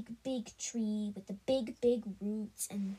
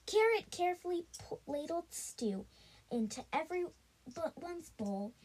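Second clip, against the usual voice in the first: usual speaking voice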